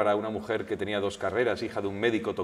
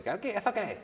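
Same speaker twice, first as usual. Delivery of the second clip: put-on Andalusian accent; 11 semitones higher, 5 dB quieter, faster than usual. Flamenco singer scoffing at reading to the reporter, Andalusian accent. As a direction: scoff it in a rough Jerez Andalusian accent, pitched up. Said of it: "con su acento así".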